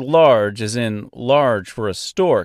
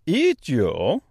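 put-on thick Austrian-French accent bird voice; amused Austrian-French accent; 5 semitones higher than usual. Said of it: "strangely thick, almost Austrian accent, mixed with a French accent".

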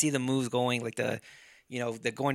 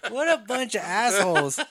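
laughing; 7 semitones higher, 4 dB louder than usual. Retold or imitated. Mocking movie fighters.

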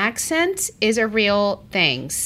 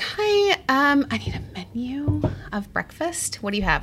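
high, timid customer voice; sweet outsider ordering breakfast; polite newcomer asking; 6 semitones higher than usual. Retold or imitated. Newcomer ordering at a deli, politely.

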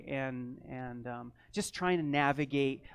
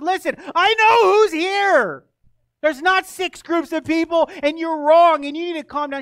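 stern rebuke; 12 semitones higher, 15 dB louder than usual. Retold or imitated. Pastor retelling telling the angry neighbor to calm down, loud. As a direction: lay down the law to her, raised. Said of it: "And I said".

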